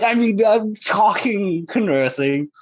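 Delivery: ordinary talk, nothing marked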